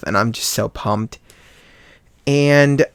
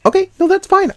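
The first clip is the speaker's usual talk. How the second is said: very high, louder "no problem" voice; retold over-accommodating squeak; high-pitched reassurance; 7 dB louder than usual. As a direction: shoot the voice up to reassure him. Acts out quick reassurance to boyfriend.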